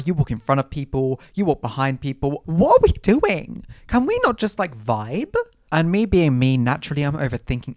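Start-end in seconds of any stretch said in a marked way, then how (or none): 2.5-5.3 raised, drawn-out mock complaint; theatrical exasperation. Model fed up with a complicated runway, exasperated. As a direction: stretch it high in mild dismay.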